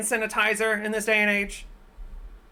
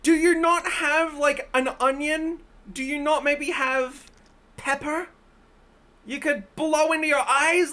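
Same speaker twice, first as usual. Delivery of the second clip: alarmed shouting; 8 semitones higher, 4 dB louder than usual. Real, not acted. Shouting at her friend not to put onion in her eye in alarm. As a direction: yell at her to stop, horrified.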